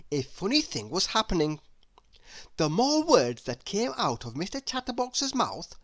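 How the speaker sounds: usual speaking voice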